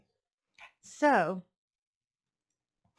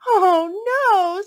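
high, very loud cry of alarm; piglet scream; 11 semitones higher, 13 dB louder than usual. A narrator's reading. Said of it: "screamed the piglet".

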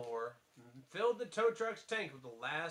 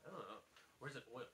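ordinary talk, nothing marked

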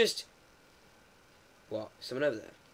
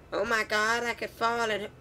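mock alarm; 6 semitones higher than usual. Player to himself over a bin, mock alarm. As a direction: play up the alarm, pitched high.

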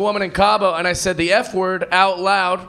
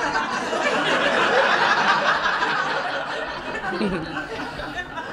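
put-on drunken giggle; 4 dB quieter than usual. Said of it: "I was just like".

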